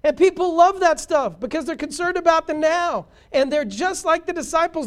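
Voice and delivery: loud, high fervent preaching; booming pulpit delivery; full-force preaching. Acted. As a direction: thunder it out to the congregation.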